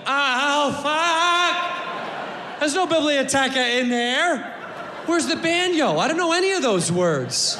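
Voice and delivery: high, drawn-out comic wail; overwhelmed bluffer caught out; mock panic. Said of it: "until you see him go".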